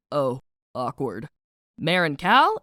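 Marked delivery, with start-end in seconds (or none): 0.1-1.3 low, slow, sheepish "oh"; awkward backing off. Acted. Nix backing off after Cal's confession, awkward and low. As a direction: shrink back slowly, voice dropped. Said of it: "Nix backs off like".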